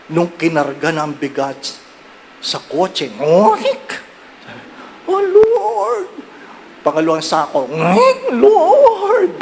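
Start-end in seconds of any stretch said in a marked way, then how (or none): 3.2-3.9 mock squeak of sagging car springs; strained car creak. Car sagging under a rice sack, imitated creak. 5.1-6.1 high squeaky wail of the overloaded car; car crying out. Retold or imitated. Overloaded car moaning to the Lord, high squeaky wail. Car complains under rice load. 7.7-9.3 high loud squeaky wail with a laugh; car crying out. Retold or imitated. Second sack loaded, car wails high and loud.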